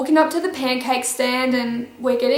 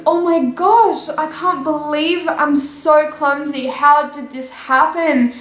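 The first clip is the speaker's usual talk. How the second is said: fake innocent shock; 2 semitones higher than usual. Feigned clumsy apology to teacher.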